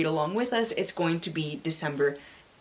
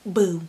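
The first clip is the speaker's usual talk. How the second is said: loud whispered boo; mock scare boo; 6 dB louder than usual. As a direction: jump out at the listener. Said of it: "mock scare".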